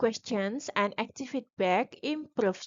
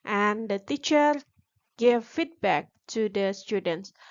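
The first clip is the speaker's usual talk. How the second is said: normal talking voice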